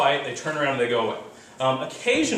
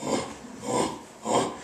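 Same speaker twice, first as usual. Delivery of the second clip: breathy rhythmic chimp panting; 4 dB quieter than usual. Shown as a demonstration. Lecturer demonstrating the start of a pant hoot. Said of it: "it's a rumbling growl".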